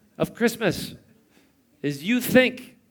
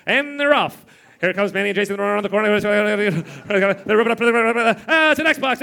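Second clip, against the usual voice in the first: mock race announcer; 2 semitones higher than usual. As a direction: call it like a horse race, then gasp at the Xbox. Kids racing down the stairs.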